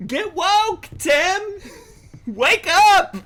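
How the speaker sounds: high, loud rallying yell. Real, not acted. Yelling at Tim in the clip to get woke, loud. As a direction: shout it out as a mocking wake-up call. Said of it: "Sorry, I'm yelling".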